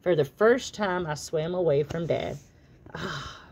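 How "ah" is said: a soft whispered ah; relieved sigh. Acted. Dad sighs softly, glad to be alone. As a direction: let the relief out. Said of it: "Dad relieved".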